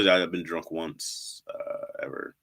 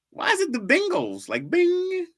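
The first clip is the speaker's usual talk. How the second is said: incredulous high; 4 dB louder than usual. Acted. Mocking chat's pronunciation.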